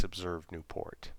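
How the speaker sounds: plain reading voice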